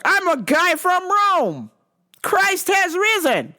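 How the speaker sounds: mock zealot lecturing a cashier; preachy Rome guy. Man repeats his line.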